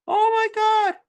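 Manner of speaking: high, loud mock gasp of wonder; high-pitched hammed-up astonishment; mock amazement. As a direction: act hugely amazed in a squeal. Mock amazement at chemistry result.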